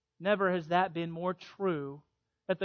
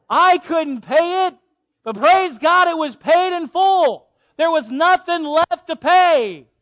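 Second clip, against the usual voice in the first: higher, louder, slow and exultant; joyful preaching; 10 semitones higher, 12 dB louder, slower than usual. Acted. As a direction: shout the good news of full payment.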